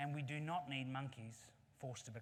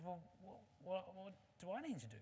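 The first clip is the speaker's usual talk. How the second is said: lightly voiced monkey, higher and halting; unsure monkey; 5 semitones higher, 3 dB quieter than usual. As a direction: stammer the question as a hesitant monkey. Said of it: "Monkey says".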